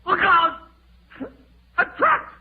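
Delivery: very high, loud shrieks of terror; terrified screams. Acted. Ramsay trapped in the vault, screaming in terror. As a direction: scream for your life, no one can hear.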